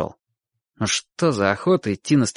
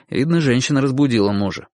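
usual speaking voice

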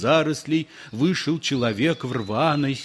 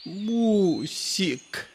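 put-on high, drawn-out babyish call; a grown man lisping like a toddler; playful toddler voice; 7 semitones higher than usual. A narrator's reading. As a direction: coo it like a spoiled little kid. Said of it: "голосом шаловливого карапуза протянул".

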